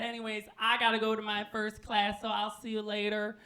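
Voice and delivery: high, breezy goodbye; sing-song high sign-off; Chevy. Acted. Chevy heads off to first class, high breezy goodbye. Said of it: "He said".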